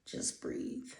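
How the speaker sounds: soft whispered calming cue; hushed, breath-only reassurance; soothing whisper. Real, not acted. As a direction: whisper it gently, with space around it. Calming the listeners.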